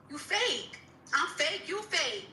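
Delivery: ordinary talk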